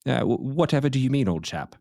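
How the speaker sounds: put-on British, innocently puzzled; pretentious British accent. Host feigning ignorance to his co-host, in a pretentious British accent. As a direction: play the baffled British gent. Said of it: "pretentious British accent".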